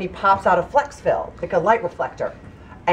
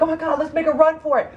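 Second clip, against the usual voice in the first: high, very fast urging; mock panic; 9 semitones higher, much faster than usual. Tourist urging friends to flee, rushed mock panic. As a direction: blurt it out in hammed-up alarm.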